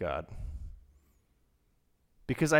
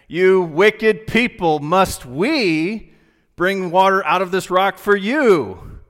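slow, loud, higher rebuke; angry Moses; 7 semitones higher, 10 dB louder, slower than usual. Retold or imitated. Moses scolding the assembled people at the rock. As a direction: thunder it down at the crowd. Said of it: "Moses said to the people".

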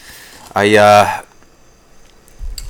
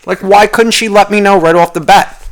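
high, loud, indignant rant; angry; 10 semitones higher, 5 dB louder than usual. Real, not acted. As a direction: demand an answer, worked up. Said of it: "am I really getting angry at that".

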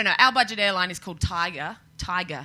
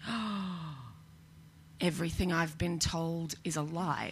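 stunned inner thought; 3 semitones lower, 8 dB quieter, slower than usual. Retold or imitated.

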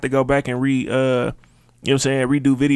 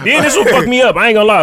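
laughing; 7 semitones higher, 7 dB louder than usual. Real, not acted. Laughing about push-ups on calls.

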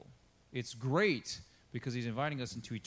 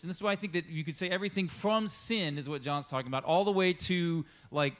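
normal talking voice

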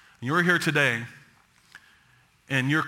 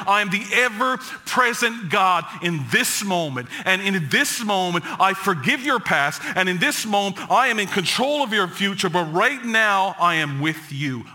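rising fervent preacher voice, a touch higher; preaching build; 4 semitones higher than usual. Acted. Pastor proclaiming God's presence to the congregation, fervent preaching. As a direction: lift the room with each line.

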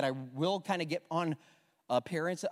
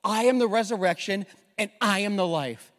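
louder, higher proclamation; proclaiming; 3 semitones higher, 7 dB louder than usual. Acted. Preacher repeating Jesus' words to the congregation, proclaimed loudly. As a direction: ring it out boldly. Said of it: "this is so powerful".

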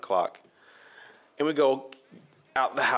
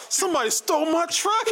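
much higher, alarmed cry; panicked disbelief. Retold or imitated. Friend realizing his truck is missing, high panicked cry. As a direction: squeal it like your truck just vanished. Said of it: "he's like".

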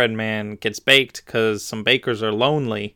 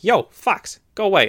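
freaked-out cookie; 8 semitones higher than usual. Acted. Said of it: "freaking out, like".

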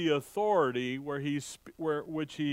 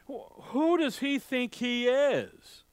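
higher, slow, sneering "who does he think he is"; scornful scoff; 8 semitones higher than usual. Retold or imitated. Preacher voicing the hometown crowd's scorn, higher and slow. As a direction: drag it out with contempt.

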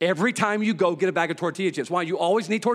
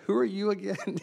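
laughing while quoting her puzzled question; puzzled line spoken through a laugh; laughing quote; 4 semitones higher than usual. Retold or imitated. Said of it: "She's like".

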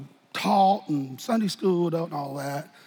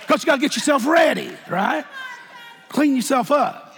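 normal talking voice

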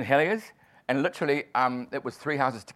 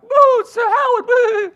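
put-on much higher, louder, slow drunken boo; drunk heckling wife; 13 dB louder, slower than usual. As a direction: boo him like a tipsy fan.

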